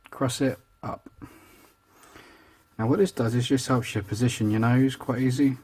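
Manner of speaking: normal talking voice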